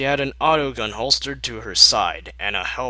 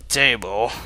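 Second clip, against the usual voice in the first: ordinary delivery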